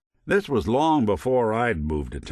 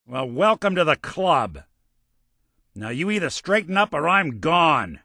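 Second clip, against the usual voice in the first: raised, higher-pitched warning; angry ultimatum; 7 semitones higher, 4 dB louder than usual. Acted. Telling a partner to straighten up or I'm gone, angry. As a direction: lay down the law, ready to walk.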